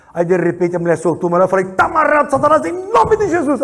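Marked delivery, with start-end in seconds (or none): 1.7-3.6 much higher and louder, commanding; rebuking shout. Retold or imitated. Pastor binding Satan in the witch, loud command. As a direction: bind the demon with full force.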